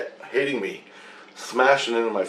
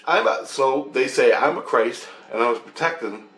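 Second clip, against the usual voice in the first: ordinary talk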